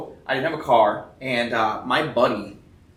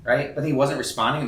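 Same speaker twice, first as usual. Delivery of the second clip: ordinary talk, nothing marked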